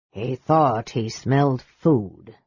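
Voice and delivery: slow, low, caustic mage. A narrator's reading. Mage mocks his brother, slow and caustic. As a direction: draw the jibe out, low. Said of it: "Raistlin said caustically".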